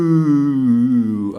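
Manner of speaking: loud, high crowd-style boo; heckler's booing, falling in pitch; drawn-out boo. Shown as a demonstration. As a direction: boo long and hard. Demonstrating booing at China.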